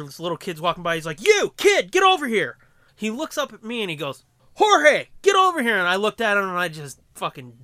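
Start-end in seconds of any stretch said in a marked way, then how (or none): 1.2-2.6 high, loud call across the room; celebrity barking at a passing kid, loud. Retold or imitated. Kato calling a kid. 4.6-5.8 high, loud call across the room; celebrity barking at a passing kid, loud. Retold or imitated. Kato calling the speaker.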